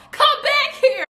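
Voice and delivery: very high mock holler with laughter; mock chasing man. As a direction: imitate a pursuer calling out, cracking up. Acts man chasing her, laughing.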